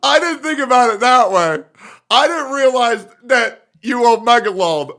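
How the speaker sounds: high, loud mock astonishment; hammed-up sudden revelation; sarcastic realization. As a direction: pretend you've just been won over, dripping sarcasm. Feigns being convinced by chatter's argument.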